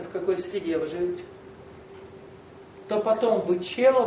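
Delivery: ordinary delivery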